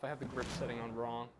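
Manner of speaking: ordinary talk, nothing marked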